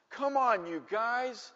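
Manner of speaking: put-on high, mildly panicky kid plea; pleading. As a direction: beg them from up on the roof. Stranded boy begs the bullies.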